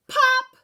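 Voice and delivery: high loud popped pop; bursting pop. A narrator's reading. Narrator making the bandito pop, loud sharp pop. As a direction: burst it out like a balloon. Sound effect in story.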